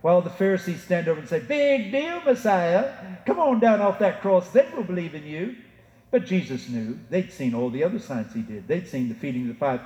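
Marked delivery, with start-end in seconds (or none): 1.4-5.5 high-pitched jeer, easing down toward the end; mocking taunt. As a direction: jeer at him like a scoffing onlooker. Pharisees taunting Jesus on the cross.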